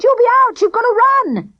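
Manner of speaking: high, loud panicked yell; shouting. A narrator's reading. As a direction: urge him to run, shouting. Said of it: "he shouted".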